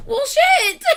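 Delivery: half-laugh, half-cry as it hits her; real tearful laughing shout; laughing through tears. Real, not acted. Overwhelmed reacting to song.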